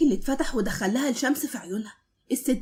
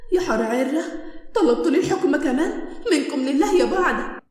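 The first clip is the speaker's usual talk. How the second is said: plain reading voice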